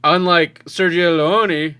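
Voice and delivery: ordinary delivery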